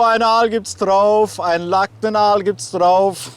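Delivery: loud, high, slow fish-market barker; market crier. Acted. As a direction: bellow it like a market stall crier. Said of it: "so als Marktschreier".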